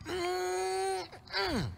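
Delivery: high squealed "mm-mm"; mock baby protest hum. Grandfather imitating grandson's squealy hum. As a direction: hum a toddler's playful no.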